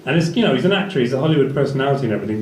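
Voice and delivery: usual speaking voice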